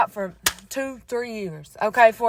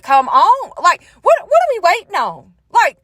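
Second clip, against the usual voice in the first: mock frustrated yell; 8 semitones higher than usual. Woman tired of waiting on God, imitating her frustrated yelling. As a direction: push it up high, hammed-up impatience. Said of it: "I've yelled and screamed in rooms".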